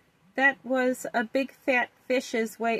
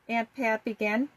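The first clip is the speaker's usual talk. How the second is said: ordinary delivery, nothing marked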